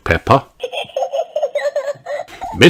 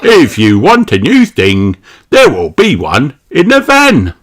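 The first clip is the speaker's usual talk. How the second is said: louder, higher salesman fox; Mister Fox voice; 7 semitones higher, 9 dB louder than usual. Acted. Mister Fox offering his van wares, jolly call.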